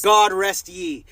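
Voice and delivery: low, slow menacing blessing; mock ominous threat. To the clinic staff, a low mock-ominous threat. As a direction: intone it like a grim priest.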